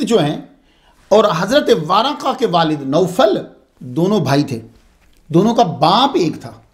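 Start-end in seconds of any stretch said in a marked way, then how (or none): none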